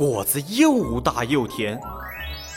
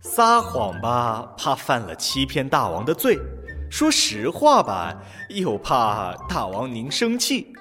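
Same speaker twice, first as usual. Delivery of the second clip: feigned reluctance. Pretend. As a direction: pretend to be torn. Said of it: "装作很为难的样子".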